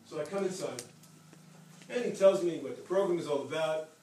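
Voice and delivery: ordinary talk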